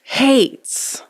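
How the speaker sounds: drawn-out, emphatic "hates"; dramatic stress. Real, not acted. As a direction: land hard on "hates" after a suspenseful pause. Dramatic reveal after long pause.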